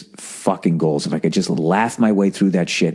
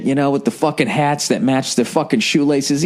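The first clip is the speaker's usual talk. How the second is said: ordinary delivery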